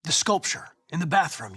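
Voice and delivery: ordinary delivery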